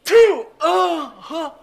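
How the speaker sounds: loud high sneeze; huge sneeze. Acted. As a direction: sneeze big and sudden. Huge sneeze mid date.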